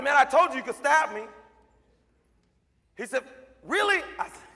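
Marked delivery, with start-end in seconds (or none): 0.0-1.3 1 loud retort. Retold or imitated. Arguing with kid. 3.6-4.0 put-on 1 excited kid. Excited kid asking again.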